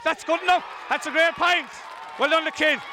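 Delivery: high excited shouting; excited cheer. Real, not acted. Commentator cheering the young player's point, excited.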